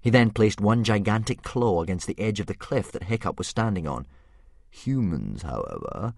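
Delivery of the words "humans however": thoughtful dragon. Acted. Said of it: "continued the dragon thoughtfully".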